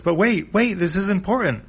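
high-pitched insistent plea; acted cartoon urgency; urgent pleading. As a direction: beg them to stop and listen. Chippu pleads as they run past.

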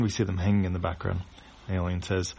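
ordinary delivery

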